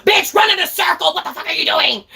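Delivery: high-pitched, frantic retold shouting; angry gamer yelling. Streamer re-enacts ordering her friend around in zombies, angry. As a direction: yell orders at a useless teammate. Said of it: "I would get so angry".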